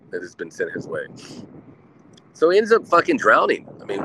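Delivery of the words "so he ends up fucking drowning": much louder and higher, shouting the punchline; emphatic outburst. Real, not acted. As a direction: blurt out the ending. Punchline of drowning story.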